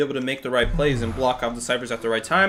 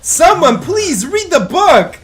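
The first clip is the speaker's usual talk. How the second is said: loud, high, slow exasperated yelling; shouting; 12 semitones higher, 12 dB louder, slower than usual. Real, not acted. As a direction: plead loudly with the team. Streamer yelling at teammates in game.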